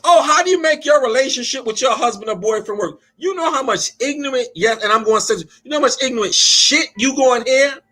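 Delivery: preaching. Acted. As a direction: preach it to the congregation. Preaching to the congregation.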